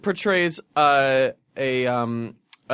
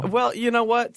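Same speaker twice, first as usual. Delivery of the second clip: usual speaking voice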